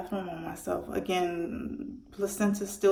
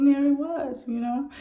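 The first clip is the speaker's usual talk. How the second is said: amazed; 7 semitones higher, 4 dB louder than usual. Real, not acted. Finding her newborn baby.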